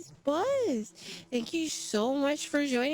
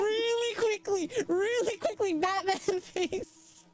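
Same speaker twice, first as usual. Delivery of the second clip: excited laughing urging; 7 semitones higher than usual. Real, not acted.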